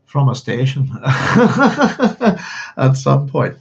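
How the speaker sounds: slow, laughing through words. Real, not acted. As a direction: let laughter break the words. Joking about new station.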